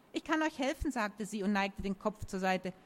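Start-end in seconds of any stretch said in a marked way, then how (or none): 0.1-0.9 higher, lightly playful youngster; younger voice. A narrator's reading. Young Time offers the danglers help, girlish. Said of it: "wurde jünger".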